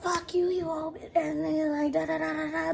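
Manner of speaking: high mock yell; mock cussing out. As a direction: fake a toxic blow-up. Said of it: "I don't wanna be like".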